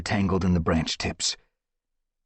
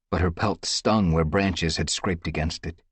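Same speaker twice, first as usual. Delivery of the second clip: usual speaking voice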